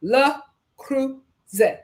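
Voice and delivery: "Le Creuset" drawn out very slowly, "luh kru-ZAY", t silent; slow French demo. Shown as a demonstration. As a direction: spell out "Le Creuset" slowly for viewers to copy, French style. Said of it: "I'm gonna put it up here for you to say it".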